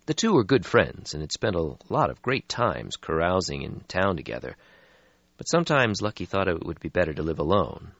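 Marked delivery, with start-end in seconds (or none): none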